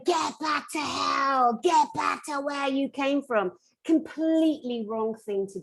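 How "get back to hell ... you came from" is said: slow, drawn-out mock yell; imitated yelling. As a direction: act out her yelled banishment, held back. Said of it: "But she was yelling".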